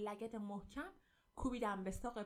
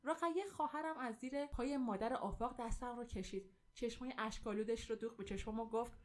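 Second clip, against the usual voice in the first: plain reading voice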